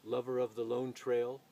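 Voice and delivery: rolling, dramatic poetry reading with swelling lines; solemn recital of a frontier poem, rising and falling; poem recitation. Acted. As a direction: declaim the verse like a lone traveller's hymn. Recites a Robert Service poem.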